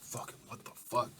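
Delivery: whispered, huffing curse; breathless whisper. Acted. Brawler panting after the fight, whispered. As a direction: gasp it out winded. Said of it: "you're a huffing and puffing".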